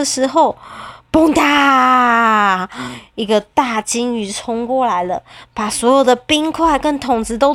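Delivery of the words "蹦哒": crash shout. Acted. 大鲸鱼冲过来.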